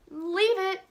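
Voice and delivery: lightly voiced little girl; firm girl. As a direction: answer calmly but firmly. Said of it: "said Annabelle".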